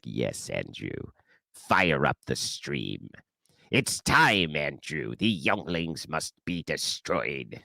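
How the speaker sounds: low, slow, menacing emperor voice; Palpatine voice. Retold or imitated. Handler Jim ordering the host to start the stream, low Palpatine. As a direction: hiss orders like a Sith master. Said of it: "the Palpatine voice that they actually do use".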